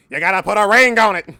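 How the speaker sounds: high, very loud joking outburst; mock Jefferson belting. Streamer teasing fans wanting Jefferson, high loud belting. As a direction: belt it out hard as a gag. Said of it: "want me to do my Thomas Jefferson voice".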